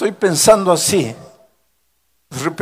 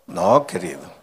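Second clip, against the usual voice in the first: low, soft "No, querido"; gentle rebuke; 10 semitones lower, 5 dB quieter than usual. Acted. Pastor gently correcting a believer, low and soft. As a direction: tenderly correct a dear listener.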